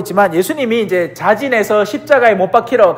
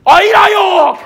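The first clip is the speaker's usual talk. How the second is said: higher, put-on Gyeongsang denial; Peter denying; 11 semitones higher, 4 dB louder, slower than usual. Peter denies to the woman, higher mock dialect voice. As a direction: brush her off in dialect, pitched up. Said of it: "옆에 웬 아낙네가".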